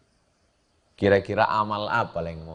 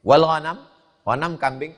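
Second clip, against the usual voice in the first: ordinary delivery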